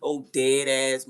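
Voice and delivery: ordinary delivery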